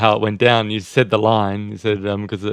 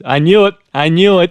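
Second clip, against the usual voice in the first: higher, louder excited mock exclamation; triumphant recognition; 7 semitones higher, 6 dB louder than usual. American tourist sure he has met a film star, excited and triumphant. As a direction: punch it out high and loud, like a pleased fan. Tourist confirms his celebrity sighting.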